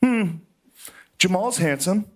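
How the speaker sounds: high, musing hum then remark; imitated girlfriend. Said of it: "she just goes".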